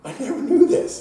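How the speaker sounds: high, loud astonished cry; mock shock. Dad learning his son sneaked beer, high and astonished. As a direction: squeal it in disbelief. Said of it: "I'm like".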